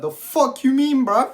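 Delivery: high, loud, slow outraged disbelief; a stunned, indignant "you what?". Retold or imitated. Replying to the manager in high, loud outrage.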